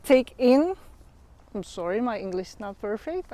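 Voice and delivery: ordinary delivery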